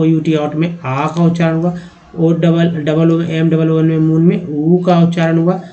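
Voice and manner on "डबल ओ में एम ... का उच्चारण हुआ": ordinary delivery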